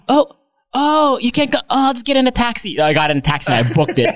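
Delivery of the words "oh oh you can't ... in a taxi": higher, chirpy pretend call; fake phone call. Faking another call to ditch the workers, chirpy pretend call. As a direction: fake a second call to escape. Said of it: "I pretend I got another call".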